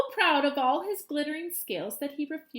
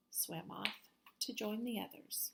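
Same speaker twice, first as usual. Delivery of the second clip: whispered; 3 semitones lower, 15 dB quieter than usual. Acted. Reluctant swimming off.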